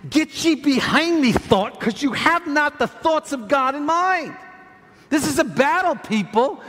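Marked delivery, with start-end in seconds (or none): 0.1-4.4 high, commanding preacher voice; forceful rebuke. Acted. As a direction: banish the thought with raised pitch. Rebuke redirected at a thought.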